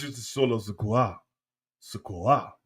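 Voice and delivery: slow, softened French "Sikoa"; French-accented "Sikoa", gently stretched; French pronunciation. Shown as a demonstration. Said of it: "If it's French".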